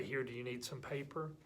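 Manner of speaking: very soft, gentle offer; nice, kind. Acted. Kind classmate offering help after being yelled at, gentle. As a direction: offer it patiently and softly. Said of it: "that person will keep being nice".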